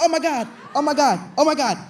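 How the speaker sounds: high, loud panicked oh my Gods; panicked yelps. Retold or imitated. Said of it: "I said".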